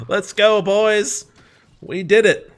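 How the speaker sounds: loud, high whoop of triumph; victory cheer. Real, not acted. Player to his boys after the boss dies, cheering. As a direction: shout it out, thrilled to win. Celebrating beating the game's boss.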